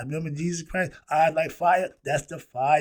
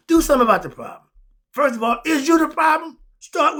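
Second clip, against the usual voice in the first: loud, much higher, fast and insistent; louder and higher, urging listeners to act; forceful command; 12 semitones higher, 12 dB louder than usual. Real, not acted. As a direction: bark it as a challenge.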